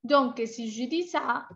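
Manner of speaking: usual speaking voice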